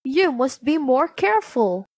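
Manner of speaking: high-voiced, insistent caution; warning dwarfs. A narrator's reading. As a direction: scold gently as a worried dwarf. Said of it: "the dwarfs warned her".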